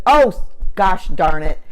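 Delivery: frustrated outburst. Retold or imitated. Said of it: "she said".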